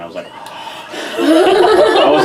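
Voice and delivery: sharp shocked gasp, slow and high; a retold gasp of shock. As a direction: gasp like a startled child. Child seeing grandma remove wig.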